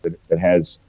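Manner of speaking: ordinary delivery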